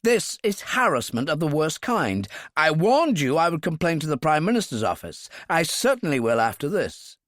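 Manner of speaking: outraged. Acted. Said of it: "Cohan was outraged".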